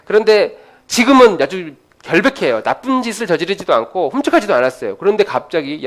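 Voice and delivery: ordinary delivery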